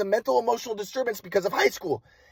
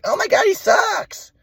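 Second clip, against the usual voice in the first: very high, drawn-out mock wail as mom; exasperated mother imitation; 13 semitones higher, slower than usual. Mom cutting off son's explanation, exasperated high mock wail. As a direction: mimic mom's high-pitched exasperation, stretching the end. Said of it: "She's like".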